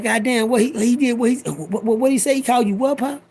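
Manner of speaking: high, stammering shock; can't believe what he just heard; outraged disbelief. Retold or imitated. Hearing the old man was insulted, high stammering disbelief.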